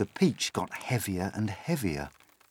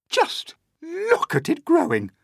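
high-pitched, louder cry of amazement; excited cry; 5 dB louder than usual. A narrator's reading. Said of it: "Aunt Spiker cried".

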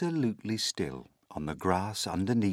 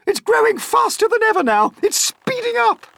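very high, loud, rapid alarm; excited shout; 10 dB louder than usual. A narrator's reading. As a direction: shout in a frantic rush. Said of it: "Aunt Spiker shouted".

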